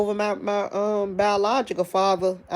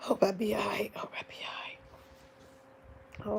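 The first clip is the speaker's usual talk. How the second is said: whisper; 13 dB quieter, slower than usual. Real, not acted. Grieving, praying to herself.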